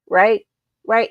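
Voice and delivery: ordinary delivery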